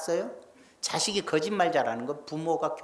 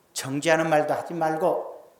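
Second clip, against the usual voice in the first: ordinary talk, nothing marked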